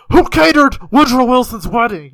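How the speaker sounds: loud, high demanding yell; interrogator shout. Pretend. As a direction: bark the question like a thug. Back alley gun-to-head interrogation.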